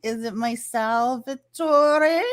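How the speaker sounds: high, slow teasing question; joking about a mafia relative; playful teasing guess. Pretend. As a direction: tease like you're in on the joke. Joking guess at mob name.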